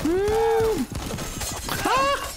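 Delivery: high yelp of panic; scared high cry. Real, not acted. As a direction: squeal as it catches you. Monster catches up.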